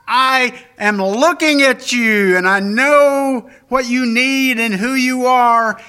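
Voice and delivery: loud, fervent voice of God promising love; God calls out his promise to each listener; preacher voicing God, loud and fervent; God speaking. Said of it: "He's saying".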